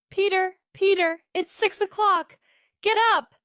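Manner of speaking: high, loud call to wake someone; shouting up the stairs. A narrator's reading. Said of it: "she shouted up the stairs".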